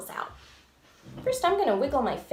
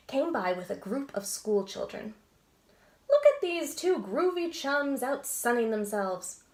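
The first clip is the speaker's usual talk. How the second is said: lively storybook read-aloud with voices; animated picture-book narration; reading to children; slower than usual. A narrator's reading. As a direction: read it like bedtime story time.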